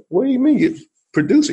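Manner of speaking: high, fast incredulous question; can't believe what he just heard; retold disbelief. Retelling meeting the drummer at a party, high quick disbelief.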